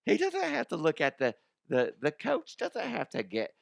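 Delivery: high put-on parent voice; hammed-up fussy parent objecting; mock complaining parent. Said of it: "I'm saying this as somebody else".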